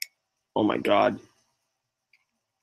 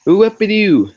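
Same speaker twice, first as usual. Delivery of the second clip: gleeful whoop; 10 semitones higher, 7 dB louder, slower than usual. Real, not acted. Hitting a ramp while racing.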